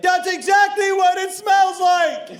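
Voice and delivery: high, drawn-out yell of an arguing woman; shrill-pitched outrage, hammed up for the retelling; indignant yell. Woman arguing with a man at the airport, indignant yell.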